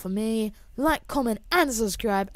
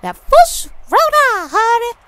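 hammy voice; 13 dB louder, slower than usual. Acted. Hamming it up after a goal.

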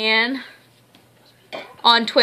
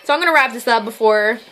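ordinary delivery

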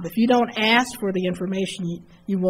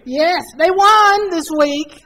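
much higher and louder, slow and proud; excited; 9 semitones higher, 7 dB louder, slower than usual. Real, not acted. A Cowboys fan answering the moderator, excited and loud. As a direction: gloat happily about the win, slowly.

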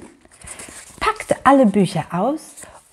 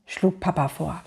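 plain reading voice

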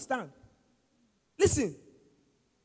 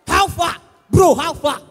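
loud, raised mock hail; hearty, punchy imitation of a greeter; boisterous street greeting; 4 semitones higher, 10 dB louder, slower than usual. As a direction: call out to your bro in imitation. Mocking loud street greeting after polite one.

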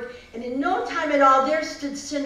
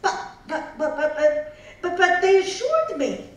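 flustered stammer; 5 semitones higher, 4 dB louder than usual. Acted.

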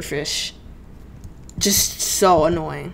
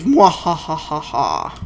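evil laugh; 4 semitones lower, slower than usual. Pretend. Evil laugh at next wave.

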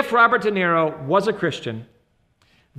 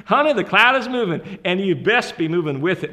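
put-on Southern accent; 3 dB louder than usual. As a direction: repeat it in a Southern wife's accent. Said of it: "that's exactly how she told me".

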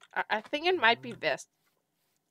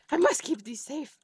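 usual speaking voice

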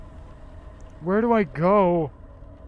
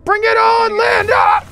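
high, loud battle cry; shouting challenge; 11 dB louder than usual. Real, not acted. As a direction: scream the dare at her.

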